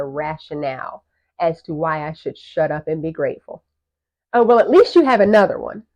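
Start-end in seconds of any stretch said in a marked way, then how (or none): 4.3-5.8 higher, louder imitation of a tactless well-wisher; put-on dismissive cheer; mock consolation. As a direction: parody someone brushing off the loss. Quoting tactless consolation from others.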